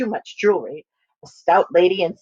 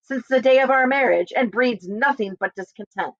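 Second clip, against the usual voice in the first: angry husband; 5 semitones higher than usual. Acted. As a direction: snap at the foolish woman. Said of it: "with displeasure and dislike".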